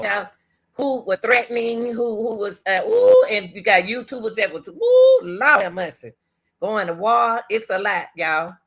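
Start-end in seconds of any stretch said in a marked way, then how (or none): 2.7-3.3 squealed ooh, much higher; a shocked high ooh; mock-scandalized hoot. Real, not acted. Reacting to the scandal. 4.7-5.2 squealed ooh, much higher; a shocked high ooh; mock-scandalized hoot. Real, not acted. Reacting to the scandal.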